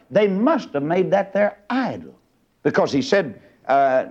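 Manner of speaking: normal talking voice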